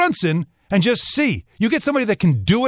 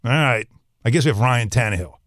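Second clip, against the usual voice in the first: low, deflated acceptance; resigned fan; 7 semitones lower than usual. Acted. Disappointed Jets fan reading the Tannehill alert, resigned. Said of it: "And you're gonna go".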